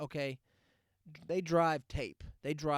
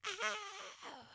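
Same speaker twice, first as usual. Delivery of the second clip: much higher, breathless mock mom; mocking a frazzled mom chattering, much higher; gushing mom chatter; 7 semitones higher than usual. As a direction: babble like a late frazzled cheer mom. Acting out the mom's chatter.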